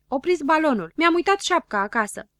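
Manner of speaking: high, loud cry of alarm; alarmed shouting. A narrator's reading. Zăpăcilă finds he left his cap at home, shouting. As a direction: shout for the balloon to stop. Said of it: "începu el să strige".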